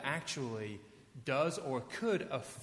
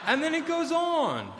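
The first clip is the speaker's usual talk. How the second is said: much higher and louder, incredulous laughing squeal; bewildered outburst; 10 semitones higher, 11 dB louder than usual. Real, not acted. Pastor reacting to absurd song lyrics, loud and incredulous. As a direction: shriek in disbelief at the lyric. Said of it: "mock bewildered outburst".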